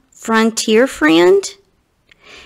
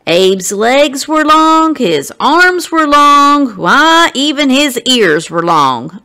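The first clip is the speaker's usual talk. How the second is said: put-on higher, louder, slow country boy; Alston's voice; 5 semitones higher, 4 dB louder than usual. Alston bragging about his friend's long limbs, in a raised country-boy voice. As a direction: drawl it out like an admiring older boy. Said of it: "Alston would say later".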